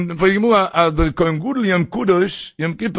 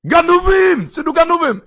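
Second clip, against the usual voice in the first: thief alarm shout; 10 semitones higher than usual. Retold or imitated. Watchman on the tower warns of thieves, shouting high. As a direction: shout the warning at the top of the voice. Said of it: "da muss ich schreien".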